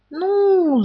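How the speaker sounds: high hesitant "Ну"; hesitant Pooh. A narrator's reading. Pooh hesitates to the rabbit, high hem. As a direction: hem before answering. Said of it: "замялся Пух".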